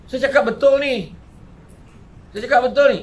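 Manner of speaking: high, loud, insisting every word is true; emphatic insistence. Real, not acted. Preacher to audience, loudly swearing the story is true. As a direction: pound it home to the crowd.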